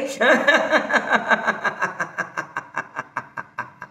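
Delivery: long rhythmic laugh, fading away; released laughter. Shown as a demonstration. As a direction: let the breath out as a long staccato laugh. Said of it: "श्वास घ्या आणि". (श्वास सोडत लांब हास्य)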